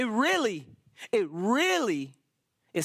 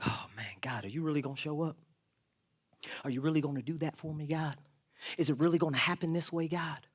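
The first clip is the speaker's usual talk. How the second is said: hushed and fast, doubting God at night; anxious whispered prayer; 4 semitones lower, 6 dB quieter, faster than usual. Retold or imitated. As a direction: whisper it quickly like a worried prayer on the bed. Anxious bedtime prayer acted out.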